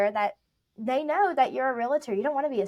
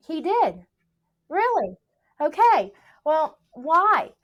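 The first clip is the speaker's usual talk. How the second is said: mock surprise; 5 semitones higher, 6 dB louder, slower than usual. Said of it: "And you're like".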